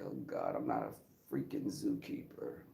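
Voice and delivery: low, soft exasperated aside; inner grumble. Retold or imitated. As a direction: drop way down and grumble it to yourself. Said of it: "I was like".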